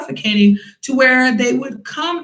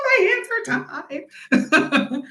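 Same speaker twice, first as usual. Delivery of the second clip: laughing resigned quip; 4 semitones higher, 3 dB quieter, much slower than usual. Retold or imitated. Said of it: "I'm like".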